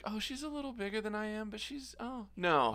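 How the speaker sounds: imitating himself. Retelling blind date.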